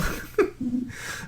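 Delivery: laugh. Real, not acted.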